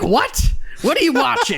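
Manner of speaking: high, incredulous mock outcry; shocked mom. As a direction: imitate an alarmed parent, pitch jumping up. Retelling mom's shocked reaction.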